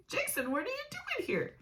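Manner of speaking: mock fake surprise. Retelling what set him off, softly mocked fake surprise. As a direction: replay that innocent question. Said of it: "attacking me for saying".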